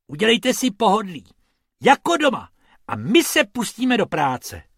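high, loud, quick rabbit voice; high rabbit welcoming the tourists; rabbit announcing. Acted. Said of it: "oznámil Bob".

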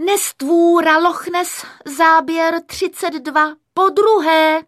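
high, loud film-slate call; clapper announcement. A narrator's reading. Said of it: "hlásila Klapka". (hlasité vysoké ohlášení klapky)

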